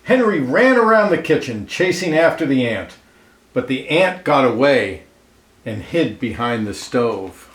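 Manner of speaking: lively read-aloud storyteller voice, clear and even; storybook narration. A narrator's reading. Reading a picture book aloud to children, lively storyteller voice. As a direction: tell it like a picture book for kids.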